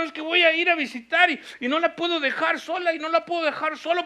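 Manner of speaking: high, loud impassioned preaching; fiery pastor exhorting the church; preaching at full force. Acted. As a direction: drive the point home to the congregation.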